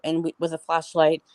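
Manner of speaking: usual speaking voice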